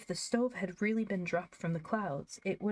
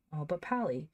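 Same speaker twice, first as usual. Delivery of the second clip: plain reading voice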